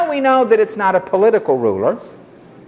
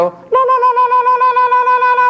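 very high, rapid la-la ululation; imitated mourning wail; 4 dB louder than usual. Preacher showing how Bedouin women mourn, high imitated wail. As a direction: imitate a shrill keening trill, held on one high note. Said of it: "When they mourn, the women go".

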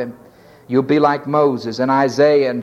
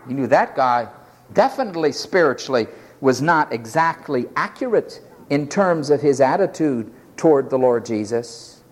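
usual speaking voice

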